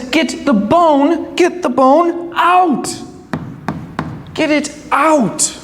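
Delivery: high, insistent wolf voice; urgent plea. A narrator's reading. Choking wolf begs crane to pull bone out, urgent. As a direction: beg urgently, pitched well up.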